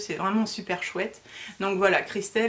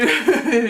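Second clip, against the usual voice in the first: a quick embarrassed burst of laughter, louder; short nervous laugh; 3 semitones higher, 7 dB louder than usual. Real, not acted. Telling viewers how scared she was, nervous laugh.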